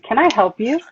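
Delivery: pointed challenge. Retold or imitated. Confronting stranger in driveway.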